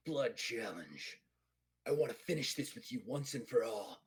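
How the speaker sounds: low-key, restrained Klingon challenge; grim Klingon challenger. Acted. Klingon challenging his rival to finish it, grim. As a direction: issue the challenge with contained intensity.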